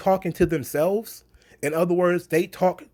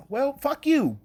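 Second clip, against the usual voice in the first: mock crowd retort; 8 semitones higher, slower than usual. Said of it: "the people are like".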